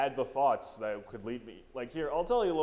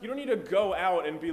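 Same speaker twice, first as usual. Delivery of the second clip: high loud disbelief; exasperated rant; 8 semitones higher, 4 dB louder than usual. Acted. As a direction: scold him, fed up. Ranting at Chick-fil-A guy.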